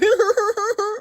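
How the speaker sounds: a short high burst of laughter. Real, not acted. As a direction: laugh at your own joke. Laughing at own mockery.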